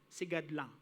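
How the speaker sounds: ordinary talk, nothing marked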